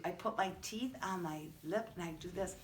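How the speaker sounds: softly "Francie Fish says" with teeth on lip; teacher showing F sound behind the mask; soft demo. Shown as a demonstration. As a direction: quietly model the F sound. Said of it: "show you".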